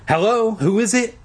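high-pitched demand into the phone. A narrator's reading. Kid answering a late-night phone call, demanding. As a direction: demand to know who is calling. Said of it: "I demanded".

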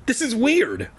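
put-on very high little boy voice; tiny, shaky voice. A narrator's reading. Invisible little brother to his brother, tiny high voice. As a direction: pitch it right up, a small kid unsettled. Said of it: "His voice sounded tiny, shaky".

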